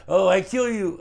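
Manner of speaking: loud mock Mexican accent that slips toward Australian; a bad Mexican accent, punch "kill". Imitating a threatening Mexican man to a friend, bad Mexican accent. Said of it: "I sound fucking Australian".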